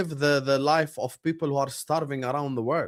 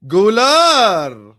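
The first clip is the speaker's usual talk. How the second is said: loud high yell of the player's name; excited shout; 6 semitones higher, 12 dB louder than usual. Real, not acted. Streamer shouting a player's name during the match. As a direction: shout it at the screen.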